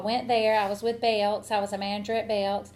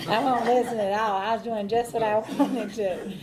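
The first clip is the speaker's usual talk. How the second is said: amused, laugh breaking into the words; laughing confession. Real, not acted. Speaker owning up to not listening in church, laughing. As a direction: confess it through a chuckle. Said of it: "I'm laughing because".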